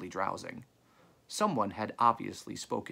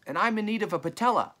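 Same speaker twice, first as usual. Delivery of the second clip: quick, high, loud request; calling out; 10 semitones higher, 6 dB louder, faster than usual. A narrator's reading. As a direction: rattle it out high across a distance. New voice calls out a want.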